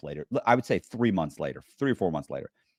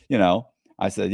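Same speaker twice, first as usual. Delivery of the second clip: normal talking voice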